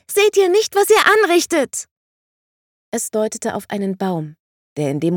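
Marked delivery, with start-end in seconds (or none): 0.1-1.9 put-on very high, loud child's cry; a little girl pleading with the grown-ups; desperate child. A narrator's reading. The child pointing at the melting tree, pleading: high desperate cry.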